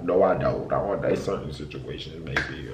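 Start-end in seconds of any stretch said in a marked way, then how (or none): none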